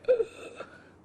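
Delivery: sharp excited intake of breath; awed gasp. Retold or imitated. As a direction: gasp in sudden wonder. Reenacting first sight of set.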